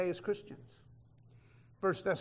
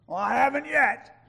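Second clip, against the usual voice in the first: mock retort; 6 semitones higher, 8 dB louder than usual. Acting the self-righteous reply.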